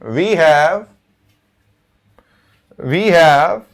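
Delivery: drawn-out phrases with long gaps; halting write-along delivery; slow dictation. Real, not acted. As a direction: dictate slowly as you write each piece. Dictating a sentence while writing it.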